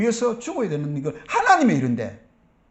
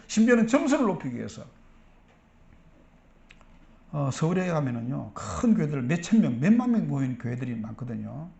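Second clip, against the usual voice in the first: normal talking voice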